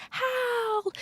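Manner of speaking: very high, softish call; high drawn-out name call; Sophie impression. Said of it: "She says it exactly like that".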